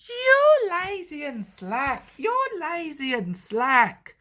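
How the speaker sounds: slow sing-song jeer; rude coaches. A narrator's reading. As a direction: taunt them slowly like cheeky coaches. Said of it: "in their quiet, rude way".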